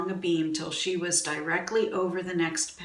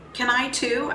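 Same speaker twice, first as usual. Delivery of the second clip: higher-pitched, hopeful little question; eager child chiming in; 6 semitones higher, 3 dB louder than usual. A narrator's reading. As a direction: pipe up like a child wanting a turn. Second child chimes in eagerly.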